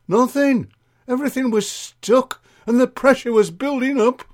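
high, loud comic lament; gossiping Mavis, dramatic. Acted. Acts Mavis telling her woes.

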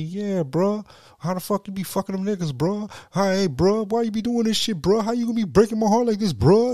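high, pleading whine with bruh on every line; whiny high mock lover. Young man whining on the phone to his girl, high and pleading. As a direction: plead like a heartbroken boyfriend, rising bruh. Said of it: "he said he talking to his girl".

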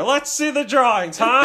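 high, loud dad imitation; exasperated dad. Dad demanding to see the drawings, loud mock exasperation. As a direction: badger your kid in a hammed-up dad voice. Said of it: "he's just so exasperated".

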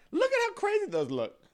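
high, loud squeal of delight; squealing excited. Real, not acted. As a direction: gush at the pictures. Repeated with contrast.

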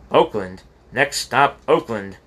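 a lightly voiced conductor announcing the next stop; conductor calling the stop. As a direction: sing out the station like a conductor.

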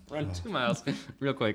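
teasing through laughter; laughing. Real, not acted. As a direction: laugh while repeating it.

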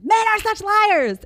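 high, loud and drawn out, sneering; mocking women's slogan. Host mocks women's complaint about men, sarcastic sing-song.

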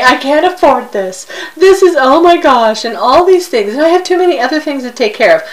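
high, fast, frantic mock complaining; mock panicked fit. Retelling her own fit to a friend, frantic and fast. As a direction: replay your own meltdown, hammed up. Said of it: "I had a fit".

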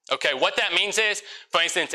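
ordinary delivery

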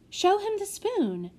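put-on girl nagging the boy to hurry; impatient. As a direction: hurry him up, sharp and high. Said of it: "said Prudence impatiently".

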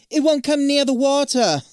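put-on high, loud British accent. Zoe declares the beast's weakness, British accent. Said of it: "Why is she British?".

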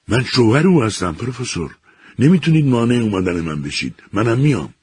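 high, loud, stubborn protest; husband demanding to join the search; defiant insistence. A narrator's reading. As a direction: refuse to be left behind. Husband insists on coming along.

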